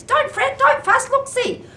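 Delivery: very high squeaky mouse voice. Acted. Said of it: "Mouse squeaks".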